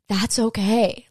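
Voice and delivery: ordinary talk, nothing marked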